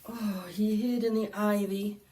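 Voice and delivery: usual speaking voice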